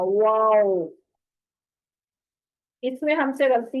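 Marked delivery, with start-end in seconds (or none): 0.0-0.9 वाओ with fully rounded lips and no teeth on the lip; Arabic waw, lips fully round. Shown as a demonstration. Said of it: "होंठों को मुकम्मल गोल करके तो फिर वाओ अदा होगा".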